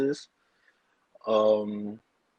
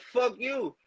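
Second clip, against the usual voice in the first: retort; 11 semitones higher, 4 dB quieter than usual. Retold or imitated. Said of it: "So I be like".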